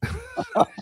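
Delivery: a quick high-pitched laugh; amused squeal of laughter; high giggle. Real, not acted. Laughing at daughter's mistake.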